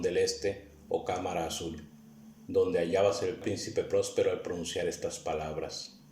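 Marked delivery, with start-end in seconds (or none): none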